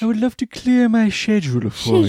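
put-on high, loud posh British accent; hammed-up posh Brit. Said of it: "sound posh and British".

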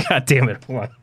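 laughing through the words; giggling, can't keep it together; stifled giggles. Real, not acted. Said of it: "I was really trying not to laugh".

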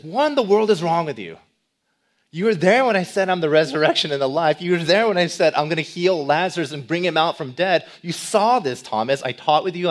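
loud, exasperated rebuke at a doubting friend; angry scolding. Pretend. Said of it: "this isn't an angered Jesus".

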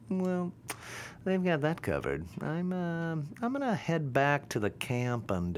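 high-pitched, hesitant excuse-maker; sheepish beta guys. Acted. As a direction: put on a high voice, hedging your way out of the fight.

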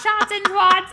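high, loud gleeful quote; excited. Retold or imitated. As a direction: shout it with delight. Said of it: "I was like".

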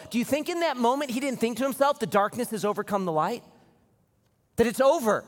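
rising sermon intensity; full-force preaching. Acted. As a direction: build the scene up for the church.

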